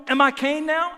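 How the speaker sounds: loud, high defiant comeback; with an attitude. Retold or imitated. Talking back to God in anger, with an attitude. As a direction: throw it back with acted attitude. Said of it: "With an attitude".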